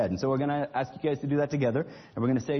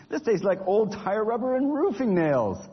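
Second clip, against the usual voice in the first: disgusted; 6 semitones higher, slower than usual. Acted. Nigel disgusted by food.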